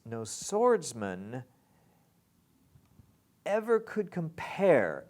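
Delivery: EVER high and loud, slow phrase; EVER stressed. Shown as a demonstration. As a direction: swing ever could compare as one unit. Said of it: "kind of all belongs together".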